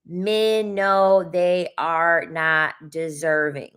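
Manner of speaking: slow, every word spelled out; "they are not" drawn out word by word; slow and deliberate. Real, not acted. Telling her chat the hard truth slowly, word by word. Repeating the point for emphasis.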